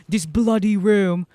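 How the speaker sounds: slow put-on British "bloody", stretched out; tongue-in-cheek English accent on "bloody"; mock British accent. Shown as a demonstration. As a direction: ham up a British "This bloody room". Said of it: "I don't mean that as an English, uh, saying".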